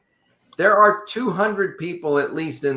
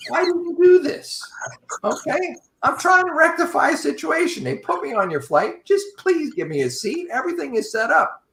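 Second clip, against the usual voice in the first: much higher, pleading in disbelief; exasperated plea; 11 semitones higher than usual. Retold or imitated. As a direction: beg the agent for a seat, voice jumping way up. Pleading with gate agent.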